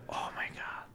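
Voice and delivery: whispered under the breath; barely audible whisper, retold unease; hushed dread. Retelling dread in haunted empty room.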